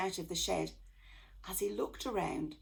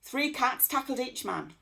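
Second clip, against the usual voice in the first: ordinary delivery, nothing marked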